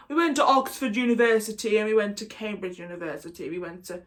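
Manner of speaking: caricatured posh Oxbridge English; mock posh English accent. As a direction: show off the poshest English accent you can. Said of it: "that's as posh as we get".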